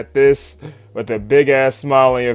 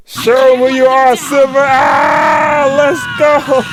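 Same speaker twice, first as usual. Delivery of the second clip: cheering, much higher and louder; hype shout; 12 semitones higher, 7 dB louder, slower than usual. Real, not acted. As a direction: yell it like the hero just showed up. Hyping up Silver's big save.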